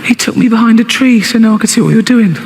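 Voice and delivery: a hint of a six-year-old; little girl voice. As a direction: daughter tells her father what happened, childlike. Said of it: "She's only six".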